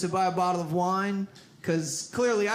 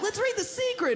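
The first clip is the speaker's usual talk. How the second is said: nerdy dude; 10 semitones higher than usual. Retold or imitated. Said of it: "One dude's like".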